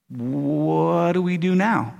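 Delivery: very slow, drawn-out first word; hesitant question. Retold or imitated. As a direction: stretch it out as a cautious asker. Voices the hesitant first asker.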